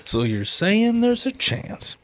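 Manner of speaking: high, slow, dopey movie-character voice; goofy movie voice. Retold or imitated. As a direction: echo it like a clueless movie hero. Repeats line in goofy voice.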